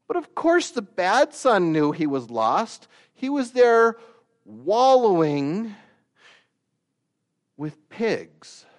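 loud, high preaching that stretches out wallowing; preaching at full force. Acted. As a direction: build and hammer the lost son's misery. Preacher building to a peak.

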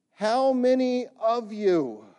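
put-on British accent. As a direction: cave diver's British accent, called out. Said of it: "in a British accent".

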